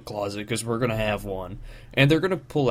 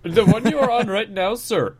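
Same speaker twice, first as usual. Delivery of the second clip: high, loud imitated Indian accent; mock Indian accent; 10 semitones higher, 6 dB louder than usual. Friend helping out as the scam caller, mock Indian accent. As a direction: put on a pitched-up Indian accent. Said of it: "do an Indian accent".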